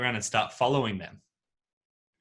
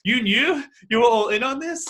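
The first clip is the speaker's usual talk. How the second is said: much higher and louder, stunned realisation; shocked disbelief; 11 semitones higher, 5 dB louder than usual. Retold or imitated. The pranked man realising his friends were in on it, stunned. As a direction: gasp it out high as the penny drops. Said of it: "He's like".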